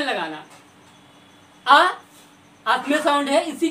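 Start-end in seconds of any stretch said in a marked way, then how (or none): 1.7-1.9 loud open "आ" from the throat; sounding the vowel a. Shown as a demonstration. Teacher showing students the a sound, open. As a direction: voice "आ" openly with the vocal cords. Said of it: "आपने बोला आ".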